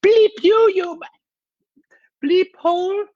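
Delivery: very high, loud mock road-rage outburst; mimicked mom losing it in traffic; imitating exhausted mom swearing. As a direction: squawk a censored curse like an angry mom. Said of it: "she just goes".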